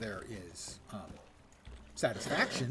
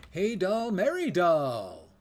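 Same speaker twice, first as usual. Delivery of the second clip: loud, high, drawn-out mock hippie call; merry singsong call; 5 semitones higher, 9 dB louder, much slower than usual. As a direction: call it out merrily, stretching the end. Mimics Tom Bombadil's merry call.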